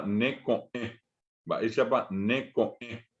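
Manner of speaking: slow ne coe, voice stopped between o and e; coe with glottal break. Shown as a demonstration. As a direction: say coe as co, tiny silence, e. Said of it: "fíjense que allí está el buzo". (ne coe lento, con corte antes de la e)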